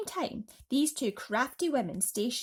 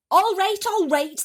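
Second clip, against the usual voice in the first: high, loud impatient reporters; crowd reply; 11 semitones higher, 12 dB louder than usual. Acted. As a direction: brush her off, loud. Crowd answers the aunt.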